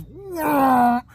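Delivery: high, loud drawn-out whine; swelling vroom sound effect; imitated machine noise. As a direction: mimic a rig zooming and wrenching down. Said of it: "and it goes".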